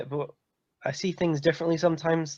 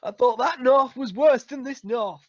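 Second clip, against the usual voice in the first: higher laughing Yorkshire grumble; mock Yorkshire accent; 7 semitones higher than usual. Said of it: "different Yorkshire accents".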